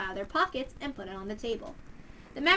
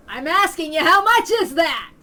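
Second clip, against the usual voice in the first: loud, higher, slowing demand; yelling; 5 semitones higher, 14 dB louder than usual. Acted. Man shouting at Ritsuko about the money, loud and higher. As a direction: shout the question at her. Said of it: "yelled at each other".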